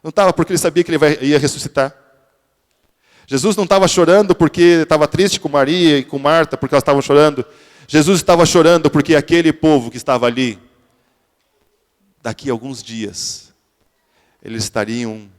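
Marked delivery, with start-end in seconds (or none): 3.3-10.6 louder, higher preacher's cry; impassioned sermon climax; fervent preaching. Acted. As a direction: drive the point home to the congregation. Preacher builds to full force.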